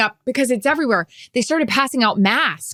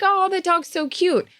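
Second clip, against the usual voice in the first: cooing at a dog; 10 semitones higher than usual. Retold or imitated.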